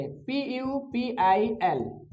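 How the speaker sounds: slow, letter-by-letter spelling; teacher's measured spelling, each letter separate. Shown as a demonstration. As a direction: spell it out slowly, one letter at a time. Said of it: "ऐसे".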